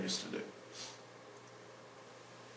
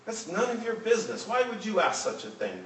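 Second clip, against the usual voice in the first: higher-pitched, mildly indignant father; angry; 8 semitones higher than usual. A narrator's reading. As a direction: read it as an irritated rebuke without raising the volume. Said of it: "the man said angrily".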